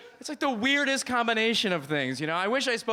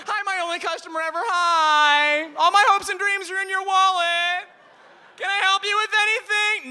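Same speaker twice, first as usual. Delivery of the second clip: desperate shopkeeper; 12 semitones higher, 8 dB louder than usual. Retold or imitated. Eager shopkeeper greets customer.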